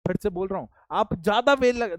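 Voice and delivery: ordinary talk, nothing marked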